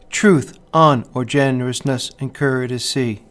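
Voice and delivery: slow, every stressed syllable punched; emphasizing the accents. Shown as a demonstration. Teacher reading Chaucer slowly to hear the rhythm, stresses marked. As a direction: beat out the iambic rhythm, slowly. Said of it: "emphasizing the accents so that we can get the rhythm".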